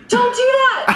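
loud, high mock yell; acted high-pitched shout; retold alarmed scolding. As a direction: yelp it at a reckless dog. Retold cry to her dog.

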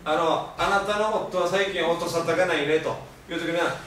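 usual speaking voice

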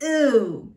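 "Ooh" as German ü, like a disgusted eww; yucky-face ü. Shown as a demonstration. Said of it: "a face when you find something yucky".

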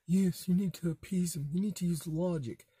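a mocking put-on voice for a spineless appeaser; mocking a coward. Host mocking a rollover who preaches appeasement, in a put-on sneering voice. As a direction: sneer it like the pushover who wants to appease them. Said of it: "yellow-bellied piece of crap".

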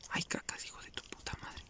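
hissed swearing under the breath; whispered curse. Real, not acted. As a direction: swear in a sharp whisper, frustrated.

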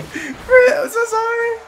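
very high, mock-tearful cry; hammed-up crying shout; wailing apology. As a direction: wail it as if heartbroken. Wailing apology to game creature.

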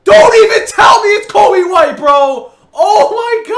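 very loud, high-pitched yell; outraged shout. Real, not acted. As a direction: scream it in real disbelief. Screaming at repeated bad pull.

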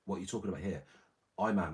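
normal talking voice

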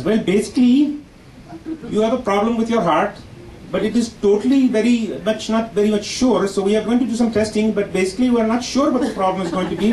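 put-on high Indian accent on "basically" and "totally"; doctor speaking with an Indian accent. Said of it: "and he says".

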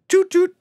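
very high, loud little doot doot; car horn imitation. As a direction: toot like drivers joining in. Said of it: "People behind are, like".

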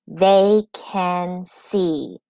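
"They can see" in three very slow beats; split into syllables. Shown as a demonstration. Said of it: "When we clap the syllables".

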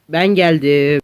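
usual speaking voice

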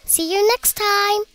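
slow, high farewell call. A narrator's reading. Pea shooters parting on their paths, calling out. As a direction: stretch it out and call it across. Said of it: "they called to each other".

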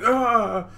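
high, loud startled gasp. Retold or imitated. Epony gasps in shock as the queen eats, loud and high. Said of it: "Epony is like".

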